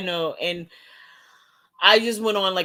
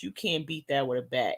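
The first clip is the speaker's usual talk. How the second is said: softer repeat; 4 semitones lower, 6 dB quieter than usual. Real, not acted.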